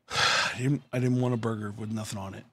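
sigh, then low, soft grumble; deflated, quiet mock complaint; disappointed complaint. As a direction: lightly imitate a let-down customer. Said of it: "she's gonna go".

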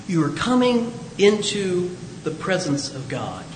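slow, hushed solemn delivery. Acted. God telling Moses of holy ground, slow hushed solemnity.

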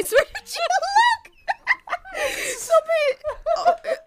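laughing as the words climb very high; helpless laughter breaking up the sentence; cracking up. Real, not acted. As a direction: dissolve into high giggles mid-oath. Cracks up at own slip.

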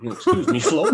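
laughing quote. Retold or imitated. Quoting the diner line, laughing.

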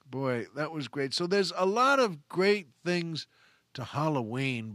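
usual speaking voice

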